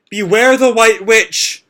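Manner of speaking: put-on possessed girl shouting a warning; dire warning shout. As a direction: call it out like a prophecy. Juvia's warning cry.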